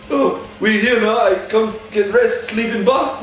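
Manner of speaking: cheerful mock driver, drawn out and high; imitating the driver. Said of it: "The poor guy goes".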